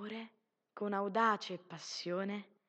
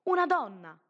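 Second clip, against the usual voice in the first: loud, impulsive outburst; exclaimed loudly, almost on instinct; instinctive cry; 3 semitones higher, 7 dB louder than usual. A narrator's reading. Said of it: "lo gridò quasi d'istinto".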